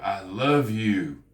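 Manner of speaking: slow, drawn-out heartfelt declaration; slow and sincere. Shown as a demonstration. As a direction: linger on it like you mean it. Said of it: "it sounds like I mean it".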